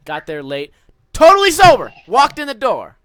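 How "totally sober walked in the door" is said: loud, pumped opener; hyped up. Real, not acted. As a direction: burst in hyped. Excited retelling, loud.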